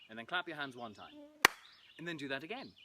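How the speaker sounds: ordinary delivery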